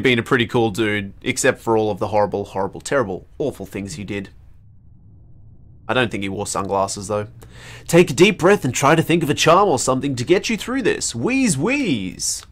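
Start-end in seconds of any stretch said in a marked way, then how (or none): none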